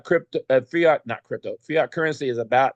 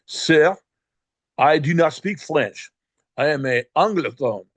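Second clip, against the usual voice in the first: put-on French accent; 4 dB louder than usual. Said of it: "speaking in a heavy French accent".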